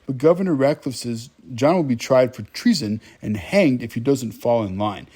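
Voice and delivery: ordinary talk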